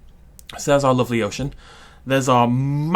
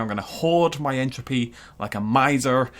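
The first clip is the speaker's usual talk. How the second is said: ordinary talk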